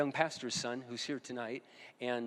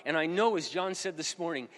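ordinary talk

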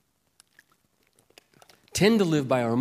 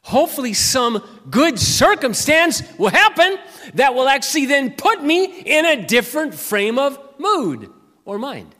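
mock grouchy hoping; 10 semitones higher, 8 dB louder than usual. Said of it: "going through the day almost like this".

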